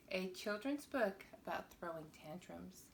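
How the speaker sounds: ordinary delivery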